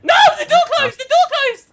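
alarmed cry, half laughing; shocked shout. Real, not acted. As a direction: yell it high in disbelief. Shocked shouting at closing door.

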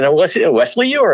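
high stage call-out; host announcing performer. Retold or imitated. Headliner calls the opener out before the crowd, announcing. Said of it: "And he said".

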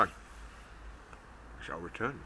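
ordinary delivery

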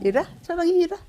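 high coaxing call to a pet; sing-song come here to the dog; talking to a dog. Real, not acted.

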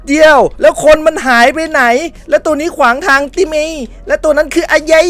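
high, loud disbelief; baffled shout. Real, not acted. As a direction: cry out in confusion. Confused after the enemy vanished. (ตะโกนงงเสียงสูง)

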